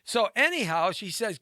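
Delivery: usual speaking voice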